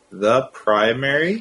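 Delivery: reading slowly on request; slow it right down. Real, not acted. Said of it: "Read slower".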